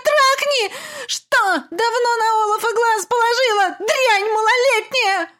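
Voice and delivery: high, loud shrieking rant building to a cry; furious screaming. Acted. Mother screaming at her daughter, high and loud. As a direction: scream it in rage. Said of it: "яростно завопила".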